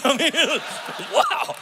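high, laughing wow; overwhelmed laughing wow; thrilled. Real, not acted. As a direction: gush with delight. Thrilled by pastor's deep voice.

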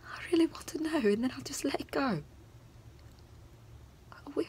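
high, strained begging building to a cry; desperate pleading. Acted. Wronged girl begging to be told why, desperate pleading. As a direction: build from a high plea to a loud, tearful outburst.

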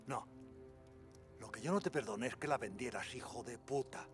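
loud furious accusation; angry shouting. Acted. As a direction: hurl it at him in rage. Furious accusation of his brother.

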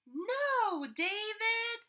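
high, loud scolding mother's cry; fed-up mom yelling at her son. Acted. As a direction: call it out like an exasperated mom. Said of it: "David's mom always said".

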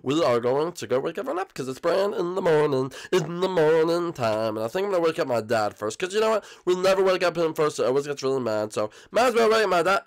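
sunny, singsong host voice; upbeat Bowser Junior. Acted. Bowser Junior welcoming viewers back to the Funhouse, upbeat. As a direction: greet viewers with stretched-out cheer.

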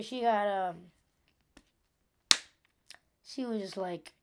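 ordinary delivery

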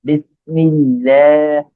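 বিসমিল্লাহ very slowly, syllable by syllable; pronunciation demo. Shown as a demonstration. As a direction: draw out the doubled l and long "laah" in বিসমিল্লাহ. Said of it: "বারবার practice করবেন".

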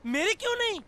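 very high whining question; indignant protest. Acted. Partner left out of newspaper photo, indignant. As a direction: pipe up high, feeling left out.

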